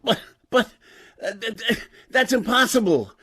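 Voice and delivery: high, panicked stammer; stammering fear. Acted. Professor refusing the woman's demand, frightened stammer. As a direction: stumble over the words in fright. Professor asked to operate again.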